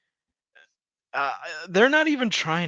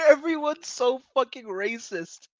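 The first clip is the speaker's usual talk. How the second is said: ordinary delivery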